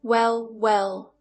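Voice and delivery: smug, condescending drawl; patronizing. A narrator's reading. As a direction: talk down to her with a smirk. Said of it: "a patronizing smirk playing on his lips".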